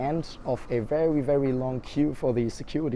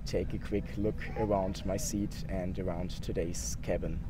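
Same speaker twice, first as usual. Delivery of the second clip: tired voice; 3 semitones lower, 8 dB quieter than usual. Real, not acted. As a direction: sound drained of energy. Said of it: "I am really tired".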